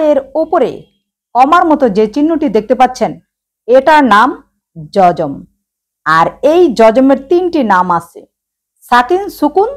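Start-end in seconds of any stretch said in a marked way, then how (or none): none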